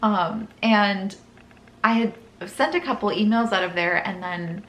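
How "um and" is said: ordinary talk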